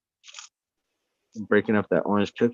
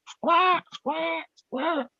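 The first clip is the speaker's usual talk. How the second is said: very high screeching bird imitation; piercing mock eagle cries; eagle squawk; much slower than usual. Shown as a demonstration. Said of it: "a goddamn eagle squawking".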